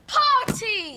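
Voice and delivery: high, loud celebratory shout; practice shout. Shown as a demonstration. Child rehearsing the party surprise, shouting. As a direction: jump out and yell it.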